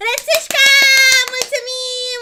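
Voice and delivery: loud high laughing shout; laughing cheer. Real, not acted. Streamer cheering donor Rățușca, laughing shout. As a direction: cheer the name out.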